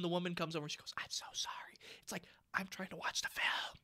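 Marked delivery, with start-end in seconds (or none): 1.0-1.8 soft hushed whisper; whispered apology. Retold or imitated. Mother apologizing after soda got kicked, whispering. 2.5-3.8 soft, hushed protest; whispered complaint. Retold or imitated. Moviegoer to the mother during film, whispered complaint.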